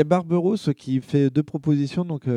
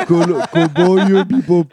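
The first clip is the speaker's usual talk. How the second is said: laughing; 4 semitones higher, 10 dB louder, much slower than usual. Real, not acted. Laughing while reading.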